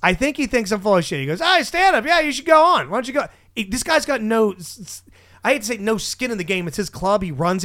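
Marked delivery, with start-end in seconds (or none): 1.4-3.3 high-pitched mock encouragement; imitated goading. Club owner urging a doubted comic onstage, mock goading. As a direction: egg someone on, pitched up high. Host doubting him urges him on.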